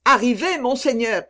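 high loud call; shouting. A narrator's reading. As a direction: yell across to him. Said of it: "crièrent les Bretons".

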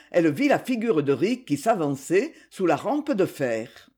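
ordinary delivery, nothing marked

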